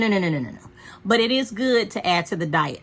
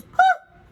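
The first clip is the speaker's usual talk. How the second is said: high squeaky dog whimper; a sudden high yelp like the dog; dog whine; 7 dB louder than usual. Retold or imitated. Owner imitating her dog's squeal, high yelp. Dog's noise when tail lifted.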